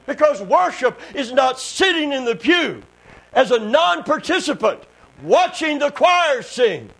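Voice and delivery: loud, high, emphatic revival preaching; fiery pulpit delivery with held phrases; full-force preaching. Real, not acted. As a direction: proclaim it to the congregation, punching key words.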